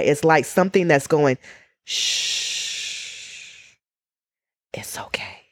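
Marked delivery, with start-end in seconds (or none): 1.8-5.4 hushed, comforting shh; gentle reassuring whisper; soothing whisper. Retold or imitated. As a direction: whisper it like calming a frightened child. Soothing inner voice quoted.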